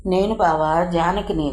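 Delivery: ordinary delivery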